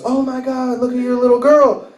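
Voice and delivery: high-pitched imitated gushing over a newborn; mock delighted squeal; gushing friend. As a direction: coo excitedly like a visiting friend.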